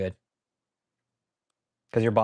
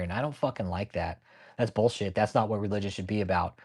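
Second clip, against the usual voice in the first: ordinary talk, nothing marked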